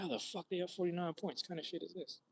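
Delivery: high squeaky outrage at a blowout; incredulous. Retold or imitated. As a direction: gasp out how this could happen. Disbelief at blowout score.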